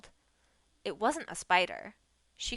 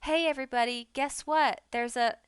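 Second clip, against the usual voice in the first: loud, higher taunting yell; 4 semitones higher, 7 dB louder than usual. A narrator's reading. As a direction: shout it up to the whole house. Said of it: "tilted his head upward and yelled".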